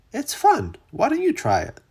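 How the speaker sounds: high, bouncy little duck voice; a high, chirpy duck; playful duck. Acted. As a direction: invite him in, full of fun.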